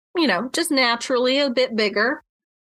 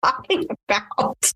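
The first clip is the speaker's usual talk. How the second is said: retold disbelief; 7 semitones higher than usual.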